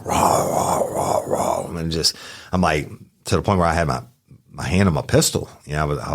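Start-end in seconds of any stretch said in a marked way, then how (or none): 0.0-1.8 low voiceless imitated growling barks; mock Rottweiler raw raw; dog growl. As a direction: growl like a big angry dog.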